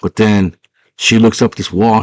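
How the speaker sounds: ordinary delivery, nothing marked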